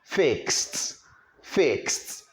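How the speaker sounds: "fixed" said as "fikst"; voiceless T ending. Shown as a demonstration. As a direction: release a clear "t" to close "fixed". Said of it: "With a voiceless consonant".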